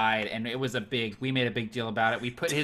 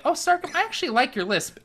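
friendly player; 12 semitones higher than usual. Retold or imitated. Quoting a fellow player.

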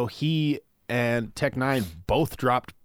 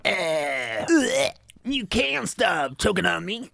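high silly voice; 9 semitones higher, slower than usual. Pretend. Teasing in high put-on voice.

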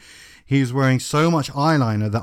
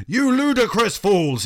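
a hammed-up headmaster telling off the students; mock shout; 9 semitones higher than usual. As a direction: boom out the insult like a pompous old wizard. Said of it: "he shouted".

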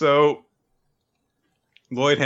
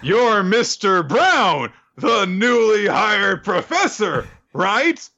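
pitched up and slow, drawn out; high slow character voice; 12 semitones higher, 4 dB louder, much slower than usual. Retold or imitated. Recapping the episode, high, slow acted exchange. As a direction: stretch it out, high and deliberate. Acting out anime scene dialogue.

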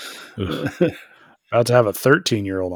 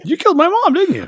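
high accusing squeal; 3 dB louder, faster than usual. Retold or imitated. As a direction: pitch way up, accusing. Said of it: "he's like".

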